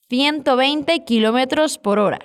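Castilian Spanish accent, "Ciento" with a th sound. Shown as a demonstration. Teacher models "ciento veinte" for learners to repeat, Spanish accent. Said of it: "con el acento español".

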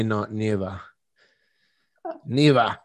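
mock Australian accent with "never" as "nivah"; lightly put-on Australian accent. Shown as a demonstration. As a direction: try out an Aussie "never". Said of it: "I like Australian accents a lot".

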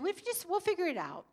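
lightly voiced carefree husband; breezy husband. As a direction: toss it off like it's no big deal. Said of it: "and he said".

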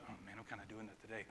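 very soft, low, quick aside; muttered realization. Retold or imitated. Preacher admitting his own fault to himself, muttered realization. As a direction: mutter it to yourself, sheepishly.

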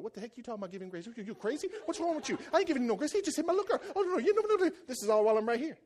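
high, rapid-fire mock outrage; indignant protest. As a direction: rant back in disbelief, pitch shooting up. Acted protest to the Holy Spirit.

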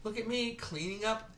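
high, slow, self-satisfied mock voice, ending loud; drawn-out sarcastic impression of a poser; mocking show-off voice. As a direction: parody a do-gooder bragging on social media. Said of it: "everyone jumped on there like".

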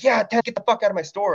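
high, fast vendor outburst; imitating the fed-up clerk. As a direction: rush him out of the shop. Clerk kicks him out.

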